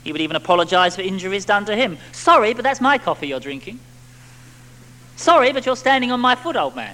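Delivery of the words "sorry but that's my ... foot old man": mock apologetic fellow. Voicing the habitual apologiser.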